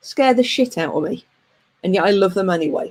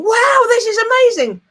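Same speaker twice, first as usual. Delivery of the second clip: much higher, louder thrilled gasp; gleeful wow; 12 semitones higher, 4 dB louder than usual. Retold or imitated. Recalling her old roller coaster thrill, excited. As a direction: squeal with delight at the top. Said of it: "I used to be thinking".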